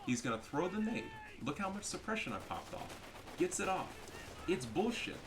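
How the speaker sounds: ordinary talk, nothing marked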